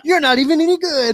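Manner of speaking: high, loud mock heckle through laughter; laughing mockery. Comedian mocking his own pep talk, high laughing jeer. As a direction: jeer it while cracking up.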